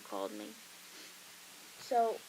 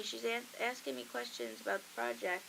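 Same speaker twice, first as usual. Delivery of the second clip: ordinary delivery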